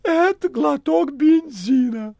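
high, loud, mock-tragic cat voice; melodramatic cat. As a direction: overplay a dying cat's lament. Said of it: "проговорил кот".

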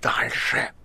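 forceful whispered shout; voiceless frenzied cry. A narrator's reading. Guest urges Ivan onward, frenzied soundless cry. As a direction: shout it with breath alone. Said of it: "беззвучно вскричал".

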